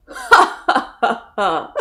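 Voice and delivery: big mock "ha, ha, ha, ha"; hammed-up lion laughter. A narrator's reading. Lion laughing at the tiny mouse, hammed up. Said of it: "lion thought this very funny".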